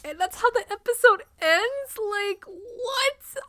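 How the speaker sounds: high, incredulous "what?"; can't believe it ended there; disbelief. Real, not acted. Shocked by the cliffhanger ending.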